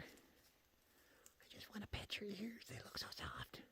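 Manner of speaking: soft whisper near a shy animal; hushed whisper. Real, not acted. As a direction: whisper it so the deer stays. Longing to pet the nearby deer.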